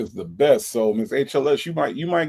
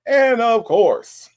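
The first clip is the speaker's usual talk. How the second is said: And of course stretched slow, higher and louder; slow, higher, louder build-up before a name; drawn-out announce; 9 semitones higher, 6 dB louder, much slower than usual. Acted. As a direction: roll out And of course like a big introduction. Grand welcome of a regular viewer.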